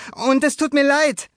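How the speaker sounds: put-on high, loud boyish sorry; boy apologizing. A narrator's reading. Luca apologizes to the neighbor while chasing, high voice. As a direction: blurt the apology as young Luca.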